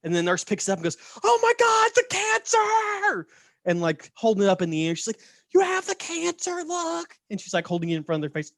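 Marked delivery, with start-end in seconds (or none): 1.2-3.3 very high, loud squeal, last word stretched out; hammed-up falsetto shriek of alarm; mock horror. Nurse shows the crowd fake cancer, mock-horrified squeal. 5.5-7.1 very high falsetto squeal; hammed-up panicky squeak; mock alarm. Nurse holds fake tumor before patient, mock-alarmed squeal.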